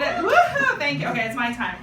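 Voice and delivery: ordinary talk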